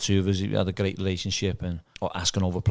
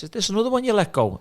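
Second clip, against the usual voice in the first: mock teasing wife; 11 semitones higher than usual. Manager's wife teasing him.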